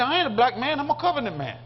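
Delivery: proud declaration. Retold or imitated. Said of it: "He said".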